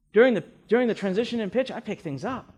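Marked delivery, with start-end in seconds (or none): none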